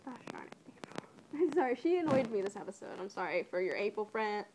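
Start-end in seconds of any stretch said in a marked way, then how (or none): 0.1-0.6 whispered grumble; very soft, whispery mutter; muttered aside. Real, not acted. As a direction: curse mildly under your breath.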